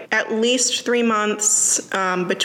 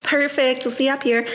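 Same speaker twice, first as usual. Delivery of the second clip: bright, higher barista sign-off; drive-thru sign-off; 4 semitones higher than usual. Shown as a demonstration. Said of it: "That was my thing".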